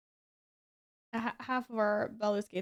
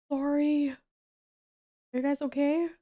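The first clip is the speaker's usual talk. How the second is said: shocked apology; 5 semitones higher, 6 dB louder than usual. Real, not acted. Streamer checking on viewers after loud blast, alarmed. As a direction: gasp then louder, higher worried sorry.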